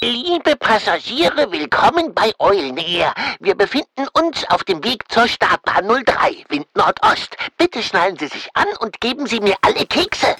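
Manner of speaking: pilot cockpit headset. Pretend. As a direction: play the pilot over the intercom. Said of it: "das ist die Durchsage".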